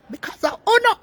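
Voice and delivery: the repeat shoots up with excitement; an emotional, high-pitched burst; excited high cry. Real, not acted. Repeats, much higher.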